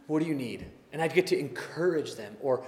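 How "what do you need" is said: much softer, gentle question; tender hushed comfort. Retold or imitated. Kneeling beside a sad person, soft tender question. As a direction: ask it like you're beside someone grieving.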